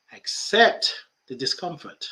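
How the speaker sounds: usual speaking voice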